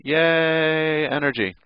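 high, drawn-out yay; hammed-up little celebration; mock cheer. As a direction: cheer it in a playful sing-out. Mock cheer for released energy.

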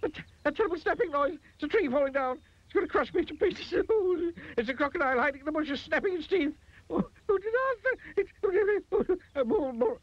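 very high, racing hysterical babble; imagine disaster upon disaster; shrieking panic. A narrator's reading. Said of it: "he shrieked".

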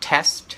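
"test" with short EH before a clear ST; short vowel. Shown as a demonstration. Tutor contrasts the second word for students, short vowel. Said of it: "อันนี้จะเสียงสั้น".

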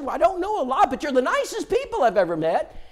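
lightly voiced sweet woman; [name]'s voice.

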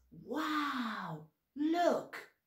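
high amazed Wow, look. Shown as a demonstration. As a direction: sound amazed at what you see. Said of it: "the next thing we do is".

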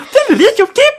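very high, chirping songbird mimicry; bird call imitation. Shown as a demonstration. Showing friends how the contest bird really sings, high chirpy imitation. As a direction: squeak the syllables out like a singing bird. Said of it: "จริงๆ จริงๆ นกมันก็".

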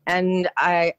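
ordinary delivery

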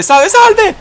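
high yelping cry for help; panicked shouting. Real, not acted. Dodging enemy attacks. (grido agitato)

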